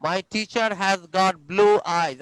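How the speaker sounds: very slow, each word separate; slow model reading. Shown as a demonstration. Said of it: "teacher repeats slowly".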